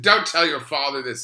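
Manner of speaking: loud, high imitation of a mother's warning; retold mother, loud and high; alarmed mother. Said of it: "She said".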